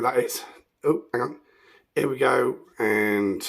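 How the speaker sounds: usual speaking voice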